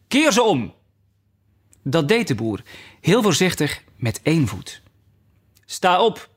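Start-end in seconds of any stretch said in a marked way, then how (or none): 0.1-0.7 high, cross royal order; angry command. A narrator's reading. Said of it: "wendde zich boos van hem af". 5.6-6.3 raised, piercing command; a touch of a shrill queen. A narrator's reading. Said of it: "zei de koningin met schelle stem".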